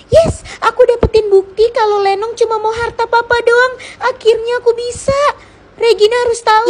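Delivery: high, loud cheer of victory; thrilled she finally has the evidence; excited triumph. Acted. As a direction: burst out with joy at getting proof. Triumph after catching the confession.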